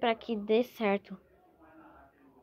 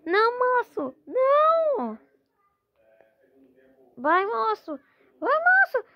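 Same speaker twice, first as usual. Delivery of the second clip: frantic high cheering; 10 semitones higher, 4 dB louder than usual. Real, not acted.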